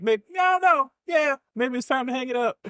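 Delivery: high, whiny mock self-talk; mock whiny doubt. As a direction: whine like you want to quit. Retold drive home doubt.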